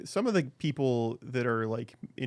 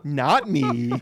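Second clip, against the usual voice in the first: mock accusing; 4 semitones higher, 4 dB louder, slower than usual. Playful blame in mock voice.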